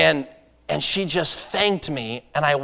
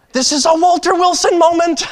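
much higher and louder, thrilled inner voice; excited thought; 7 dB louder than usual. Retold or imitated. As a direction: burst out as if the big moment just arrived.

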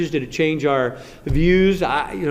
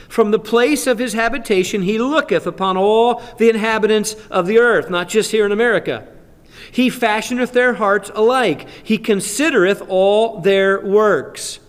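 scripture reading; 5 semitones higher than usual. Acted. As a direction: proclaim the verse with weight. Reads scripture aloud.

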